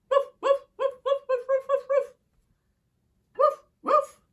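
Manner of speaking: very high, loud puppy yaps; yappy puppy barks. Acted. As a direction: bark like an excited little dog. Puppy-wolf barking in story.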